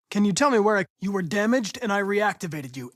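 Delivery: usual speaking voice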